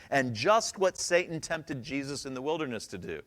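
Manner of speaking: normal talking voice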